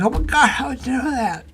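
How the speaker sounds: high-pitched shocked disbelief; astonished. Retold or imitated. Stunned listener asks how she knew, astonished. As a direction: blurt out a startled question. Retells shocked reaction to revelation.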